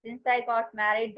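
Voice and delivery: very slow, word by word for the class; slow dictation. Shown as a demonstration. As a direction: let each word sink in slowly. Teacher dictates English example. (धीरे-धीरे लिखवाते हुए)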